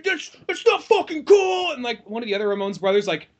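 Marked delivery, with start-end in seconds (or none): 0.0-1.7 high, put-on angry voice of a scolding rocker; mock angry rant. A rock star scolding the band over stage antics, high mock anger. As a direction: scold them like an offended punk. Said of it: "[name]'s book says".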